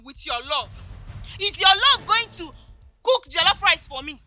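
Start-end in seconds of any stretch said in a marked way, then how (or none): none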